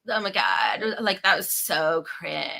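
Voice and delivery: put-on slow, fried, trailing teen drawl; a lightly voiced bored teenager; teen vocal fry voice. Shown as a demonstration. Demonstrating how teens talk today, slow and fried. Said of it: "Yes, like that".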